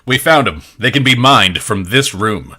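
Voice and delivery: high, loud in-character announcement; excited and projecting; wide-eyed excitement. Acted. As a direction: proclaim the find, thrilled. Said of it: "her eyes go wide".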